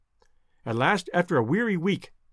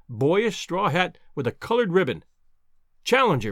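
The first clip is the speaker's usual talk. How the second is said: ordinary delivery, nothing marked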